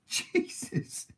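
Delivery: a high breathless gasp of "Jesus"; startled exclamation. Real, not acted. Vlogger startled by something unexpected, high breathy gasp. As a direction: caught off guard, blurt it out high and airy. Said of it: "that was very unexpected".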